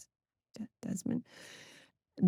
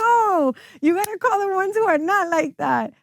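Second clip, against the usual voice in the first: higher, pushing excitedly; retelling her own eager insistence, a bit raised; insistent; 11 semitones higher than usual. Retold or imitated. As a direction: urge him on, animated. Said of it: "And I'm like".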